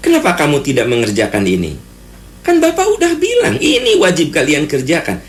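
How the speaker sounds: a lightly acted teacher's scolding, voice climbing high. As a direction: play the strict teacher telling off a class. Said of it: "Naik suaranya".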